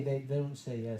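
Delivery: ordinary delivery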